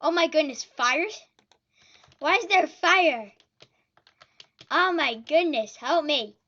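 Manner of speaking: loud alarmed exclamations; panicked shouting. Real, not acted. Fire raining in game.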